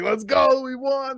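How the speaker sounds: high, loud victory cheer; a buoyant retold celebration shout; excited cheer. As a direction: cheer it like you just won. Cheering win in cheesehead.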